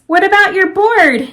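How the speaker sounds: high, loud call after him; yelling. Acted. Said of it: "Gia yelled".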